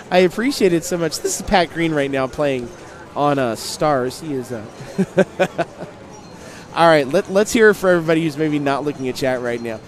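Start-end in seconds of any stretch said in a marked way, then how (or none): none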